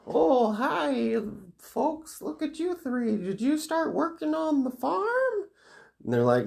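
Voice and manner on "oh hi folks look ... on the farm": very high, put-on old lady; old woman voice. Old woman greets three kids, shrieky high caricature. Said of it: "there's an old woman there".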